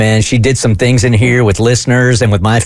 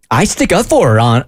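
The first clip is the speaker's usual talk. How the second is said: ordinary talk, nothing marked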